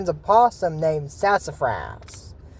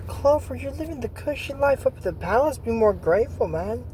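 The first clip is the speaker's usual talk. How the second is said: lightly scolding voice; 6 semitones higher, 3 dB quieter than usual. Retold or imitated. Scolding the bunny in character.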